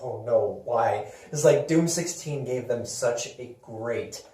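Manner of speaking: ordinary delivery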